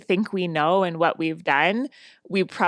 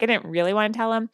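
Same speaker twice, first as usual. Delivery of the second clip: normal talking voice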